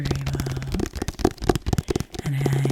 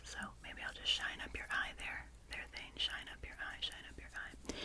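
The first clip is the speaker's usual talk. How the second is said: much softer tender whisper; tender hush; 17 dB quieter than usual. Real, not acted. Host cleaning her owl's eye, tenderly whispering to it. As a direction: murmur gently while polishing. Tending the stuffy gently.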